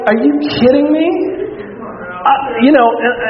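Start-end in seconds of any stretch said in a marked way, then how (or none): 0.1-1.1 высокий, недоверчивый возглас; incredulous. Retold or imitated. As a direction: spike up in disbelief. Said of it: "it's like".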